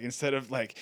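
usual speaking voice

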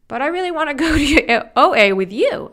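high, loud joke that breaks into a laugh. Retold or imitated. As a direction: laugh through a cheeky reply. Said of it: "And I was like".

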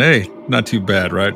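normal talking voice

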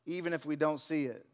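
ordinary delivery

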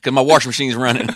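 giggly hammed-up Maryland local; Maryland accent. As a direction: crack up doing Southern Maryland. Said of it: "that's a Maryland".

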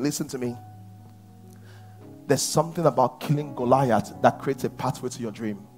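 ordinary delivery

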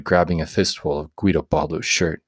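plain reading voice

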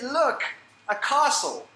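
put-on high, loud excited kid voice; excited child. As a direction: point and call out like a small boy who has spotted a castle. Boy excitedly pointing at mosque.

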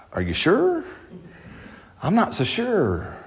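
hushed, hesitant questioning of God, acted; soft, drawn-out reluctant doubt; doubtful. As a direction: sigh and voice mock reluctance softly. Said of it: "you'd be like".

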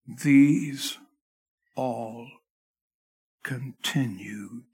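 continued stretched slow and soft at the end; drawn-out emphasis. Shown as a demonstration. Preacher drawing the congregation to the word continued, slow. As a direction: linger on each word, pulling out continued. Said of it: "notice the third word in this passage".

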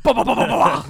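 rapid-fire "ba" punch noises; comic machine-gun combo sound effect; imitated punch flurry. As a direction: mimic a volley of hits with your mouth.

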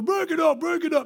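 high, urgent coach's call; acted coach intervening urgently; coach breaking up fight. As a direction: call out high, rushing in to separate kids. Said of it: "Coach runs over".

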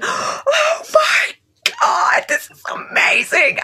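high-pitched thrilled shriek, breaking into a whisper; retold ecstatic scream. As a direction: relive the moment you heard the samples, squealing. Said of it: "I was screaming".

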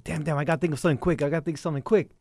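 quick, anxious inner voice; nervous rushed thinking. Retold or imitated. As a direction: rush it, panicking for an idea. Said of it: "And then I was like".

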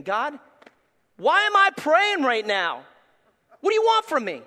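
a pastor crying out at God in frustration; frustrated outcry to God. Retold or imitated. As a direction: rail at God in exasperation. Angry prayer to God, retold.

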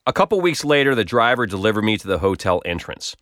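ordinary delivery, nothing marked

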